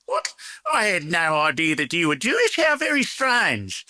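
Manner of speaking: put-on Old Thurston accent, mock surprised; doing a surprised customer in an Old Thurston accent. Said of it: "that's my best Old Thurston accent".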